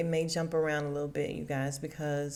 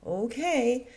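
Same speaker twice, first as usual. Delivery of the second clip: higher, dubious okay; sceptical okay; 5 semitones higher than usual. Retold or imitated. Mimicking wife's reaction to husband, sceptical. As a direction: let the doubt show in one word. Replays her doubtful okay.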